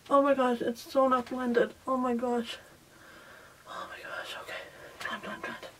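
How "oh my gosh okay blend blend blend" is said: panicked whisper. Real, not acted. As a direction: breathe it out, barely voiced. Panicked makeup timer, whispering.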